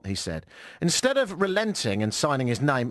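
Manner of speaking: ordinary talk, nothing marked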